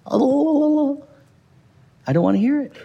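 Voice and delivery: high, sing-song blah blah with fingers in ears; childish blah blah. Retold or imitated. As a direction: play a child refusing to listen. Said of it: "stick our fingers in our ears".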